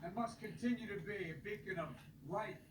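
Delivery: stirring speech building to a shout; rally the crowd, end on a rousing call. Acted. Rally speech continues, building to close.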